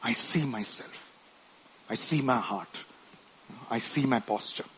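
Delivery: soft, low confessing prayer; humble prayer. Retold or imitated. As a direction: pray quietly in surrender.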